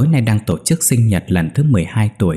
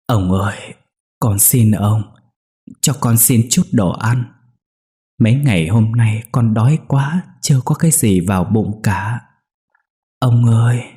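put-on a slightly raised, pleading child's voice; trembling child's plea; 2 semitones higher than usual. A narrator's reading. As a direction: beg timidly, as a frightened hungry child. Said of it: "Đứa bé run rẩy lắp bắp nói". (giọng đứa bé run rẩy van xin)

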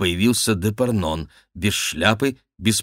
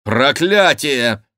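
loud, slow, higher exclamation; cried-out curse; 5 semitones higher, 6 dB louder, much slower than usual. A narrator's reading. King hears his friend was abandoned, cries out loudly. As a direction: draw the curse out in a raised cry. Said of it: "вскричал король".

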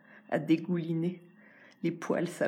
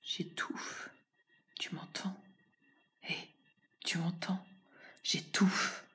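whisper; 5 dB quieter, slower than usual. Acted. Suffocating plea to an unseen you.

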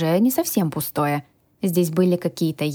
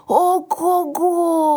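high, drawn-out whoop; long, high-pitched cry of amazement; excited yell; 11 semitones higher, 3 dB louder, much slower than usual. A narrator's reading. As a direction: whoop slowly in a high dragon voice. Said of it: "завопил он".

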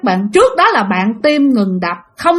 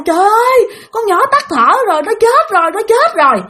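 high, wailing imitation; screaming in despair; 10 semitones higher than usual. Grandmother and mother believing child is dead, screaming in despair. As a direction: cry out in retold panic, pitched way up. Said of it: "bà ngoại rồi mẹ la quá trời".

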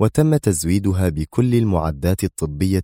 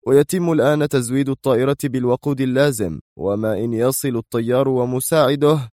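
higher-pitched subordinate reporting progress; deferential foreman; 6 semitones higher than usual. Acted. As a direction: report dutifully to the boss, voice lifted. (رئيس عمال يجيب سيده بصوت أعلى طبقة)

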